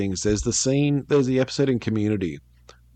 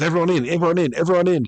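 higher, louder repeated call to pile in; urgent calling out; 9 semitones higher, 5 dB louder, faster than usual. Retold or imitated. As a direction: round up a crowd from a truck. Truck driver rounding up passengers.

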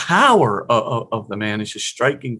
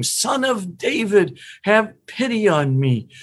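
calling out; 7 semitones higher than usual. Retold or imitated. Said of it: "he's calling out by Jesus's".

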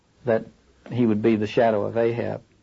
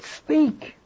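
high, falling one-word call; imploring command; 14 semitones higher than usual. Acted. He says to the head, imploring command.

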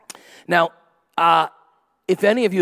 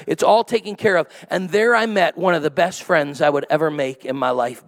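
ordinary delivery

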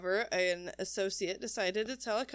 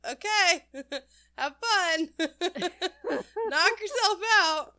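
laughing; 9 semitones higher, 4 dB louder, slower than usual. Acted. Sarcastic retort, laughing.

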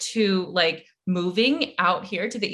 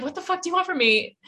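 fast, higher mock outburst at the ex; exasperated retort; 9 semitones higher, faster than usual. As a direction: fire it back quick and exasperated. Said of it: "and I'm like".

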